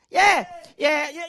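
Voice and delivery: ordinary talk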